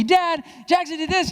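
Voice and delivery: put-on high, loud tattling child; a kid running to dad to tattle, high and whiny. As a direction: whine it like a kid telling on his brother.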